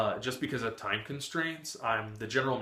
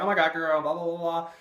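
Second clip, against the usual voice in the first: gushing impression; 5 semitones higher, 5 dB louder than usual. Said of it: "he's like".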